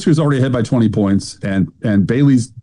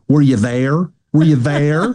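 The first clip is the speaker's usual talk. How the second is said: hillbilly candidate imitation; 3 semitones higher, slower than usual. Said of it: "hillbilly Gomer Pyle voice".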